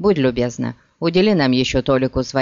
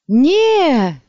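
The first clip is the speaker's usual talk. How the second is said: пронзительный высокий крик; орёт от ужаса, громко и высоко; крик раненого; 10 semitones higher, 6 dB louder than usual. A narrator's reading. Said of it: "заорал раненый". (громкий крик раненого)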